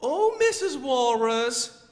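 high, loud little-bird voice; slow, pleading baby bird; Choco. Acted. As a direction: cry out high and drawn-out as a lonely chick. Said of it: "How about you be the voice of Choco?".

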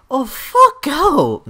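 high, drawn-out cry of frustration; exasperated yell. Real, not acted. Player fed up with his opponent, exasperated yell. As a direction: yell it in slow, pained frustration. Angry at opponent mid-match.

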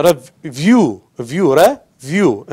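review drawn out with VIEW stressed; stress on second syllable. Shown as a demonstration. Teacher showing the strong syllable, slow stress on VIEW in review. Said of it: "the strong syllable".

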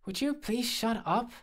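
high-pitched exasperated snap; irritated, voice jumping up; snapped. A narrator's reading. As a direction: snap at a teasing friend. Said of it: "I snapped".

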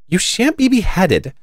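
high, emphatic reassurance; plucky protector; defiant promise. A narrator's reading. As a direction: declare it firmly, pitched high. Alice defiantly protects the gardeners.

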